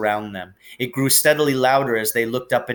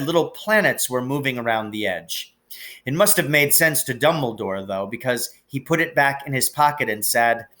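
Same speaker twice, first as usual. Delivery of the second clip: usual speaking voice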